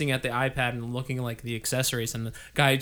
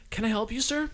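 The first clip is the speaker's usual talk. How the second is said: polite clerk; 7 semitones higher, 4 dB quieter than usual. Retold or imitated. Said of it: "People were like".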